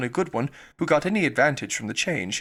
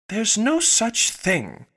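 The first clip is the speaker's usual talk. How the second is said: slow, higher, indignant protest; a mild, held-back angry outburst; very angrily; 7 semitones higher, 3 dB louder, much slower than usual. A narrator's reading. Alice objecting to the treacle well, mildly angry. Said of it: "Alice was beginning very angrily".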